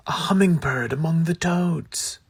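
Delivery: ordinary delivery, nothing marked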